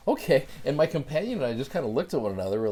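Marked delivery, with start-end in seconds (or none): 0.1-0.5 higher, louder stunned okay; taken aback okay. Real, not acted. Kid's dad doesn't know Jesus.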